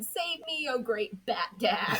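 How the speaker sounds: mock terror, hiding behind Batman; mock panicked cry. Being flees behind Batman.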